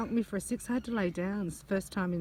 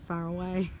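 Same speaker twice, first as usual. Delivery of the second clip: usual speaking voice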